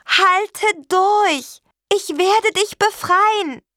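very high, loud drawn-out call. A narrator's reading. Witch to her trapped carp underwater, calling out. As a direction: shout encouragement slowly across a distance. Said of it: "rief Petronella".

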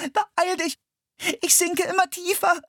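put-on high, panicked girl's cry; a sinking girl begging him to hurry; desperate scream. A narrator's reading. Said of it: "schrie Anne verzweifelt".

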